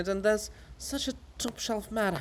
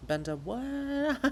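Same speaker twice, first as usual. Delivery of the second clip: high, slow puzzled ask; puzzled question; 9 semitones higher, 3 dB quieter, slower than usual. Acted. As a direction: mishear and ask, baffled.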